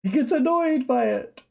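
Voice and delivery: very high squeal, breaking into a laugh; high-pitched mocking. Streamer teasing that he gets annoyed by it, high mocking squeal. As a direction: squeak it out in a silly mock voice.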